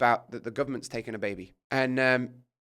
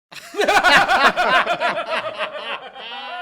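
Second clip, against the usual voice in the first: high loud burst of real laughter; 14 semitones higher, 11 dB louder than usual. Real, not acted. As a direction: break into a big laugh at the memory. Laughs at own story.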